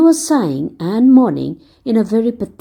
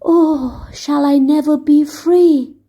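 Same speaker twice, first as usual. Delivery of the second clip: sad whisper; 6 semitones higher, slower than usual. Acted. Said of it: "whispering in saddest accents".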